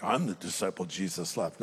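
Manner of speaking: low, smug mock boast; a hint of boasting; mock boastful. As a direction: puff it up as if bragging. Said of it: "might sound boastful".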